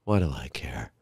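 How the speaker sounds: low, hushed dismissive grumble; indifferent younger self. Retold or imitated. Younger son brushing off dad's cruise pictures, dismissive. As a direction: brush it off quietly, low and uninterested. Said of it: "of course, I'm younger".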